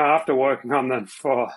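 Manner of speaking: ordinary talk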